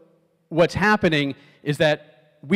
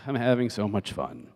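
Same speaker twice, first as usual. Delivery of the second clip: low, soft, groaning sarcasm; fed-up mock enthusiasm; sarcastic; 3 semitones lower, 5 dB quieter than usual. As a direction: sigh it out as if hating every minute. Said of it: "Or they could be saying".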